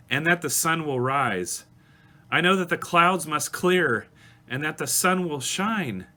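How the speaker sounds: high, heartfelt recitation in surging phrases; impassioned lyric recitation. Acted. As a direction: declare it with mounting conviction. Reads the bridge with conviction.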